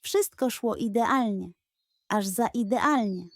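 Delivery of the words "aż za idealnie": usual speaking voice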